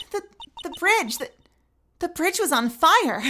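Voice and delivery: put-on high, faltering girl's voice; stunned witness repeating the news; stammering witness. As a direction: stumble over the words in alarm. Witness voice continues.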